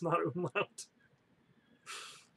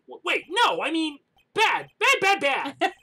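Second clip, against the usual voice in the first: flustered high voice; 9 semitones higher, 5 dB louder than usual. Acted. Character flustered correcting himself.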